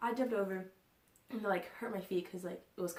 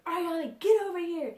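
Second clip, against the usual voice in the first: loud, high mock yell; hammed-up parental shout; imitated angry mom; 10 semitones higher, 10 dB louder than usual. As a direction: call your kid over like a fed-up mother. Mom calling her daughter sharply.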